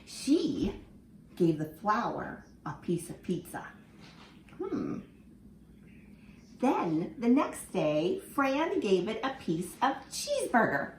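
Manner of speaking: lively storybook reading for little ones; reading to children. Acted. Teacher reading a picture book to her class, lively and expressive. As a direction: read it like story time in class.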